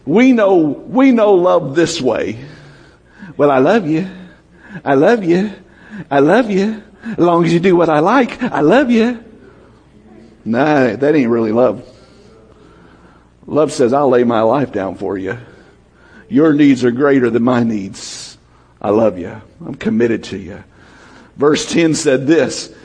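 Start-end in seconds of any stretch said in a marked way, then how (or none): 3.4-9.2 sing-song, rising I love you with a catch at the end; lightly mocking a conditional lover. 13.9-20.6 a lightly voiced loving promise, lower; tender committed love. Voicing what true love says.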